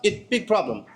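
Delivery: put-on high, Eastern European accented owner; imitated Eastern European accent. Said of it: "doing the accent".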